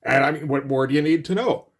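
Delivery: ordinary delivery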